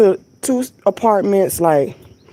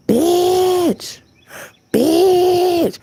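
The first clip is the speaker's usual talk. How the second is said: much higher rooster screech on each word; 12 semitones higher than usual. Retold or imitated. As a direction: crow the words like a rooster. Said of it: "This rooster was screaming".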